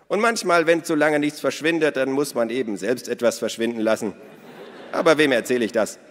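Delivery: hoch und laut, listig-verschwörerisch; verschmitzte Minna. A narrator's reading. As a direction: die Diebin prahlt augenzwinkernd. Narrator voices Minna. (hohe, laute, listige Frauenstimme)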